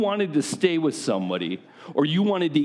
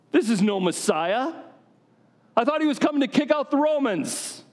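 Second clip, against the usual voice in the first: much higher, indignant mock complaint; grumbling crowd; 10 semitones higher than usual. Angry townsperson grumbles about Jesus, much higher indignant whine. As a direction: voice the outraged townsfolk, hammed-up.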